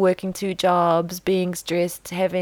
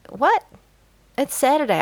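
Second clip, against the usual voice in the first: high, louder "what?" of disbelief; incredulous comeback; 8 semitones higher, 4 dB louder than usual. Retold or imitated. Woman's wished-for answer to nosy neighbor, incredulous. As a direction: shoot the question up high, astonished. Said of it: "what I really should have done".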